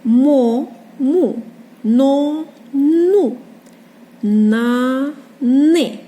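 Мо, му, но, ну, на, ни drawn out and separate; reading syllables aloud. Shown as a demonstration. Teacher reading for children, Мо, му, но in measured syllables. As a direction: sound Мо, му, но as long, even syllables. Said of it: "і читаємо".

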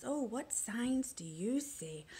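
normal talking voice

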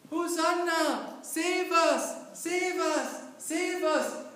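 loud, high desperate cries of Save us!; prisoners shouting. Acted. Preacher voicing prisoners begging the king to save them, desperate shout. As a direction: cry out for rescue at full voice. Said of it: "So they start to shout".

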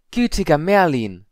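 high, loud, drawn-out gasp of astonishment; shocked exclamation. A narrator's reading. Student breaking the silence at Padma's arrival, shocked. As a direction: cry it out in alarm.

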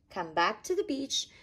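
plain reading voice

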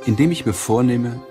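usual speaking voice

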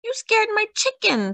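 very high squawk of complaint. A narrator's reading. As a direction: squawk it like an upset neighbour. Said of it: "squawked Senora Dominguez".

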